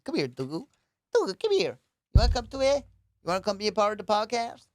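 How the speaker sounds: high, loud voice calling the dog over; coaxing pet talk. Real, not acted. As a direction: coo excitedly to a pet. Calling his dog to join him.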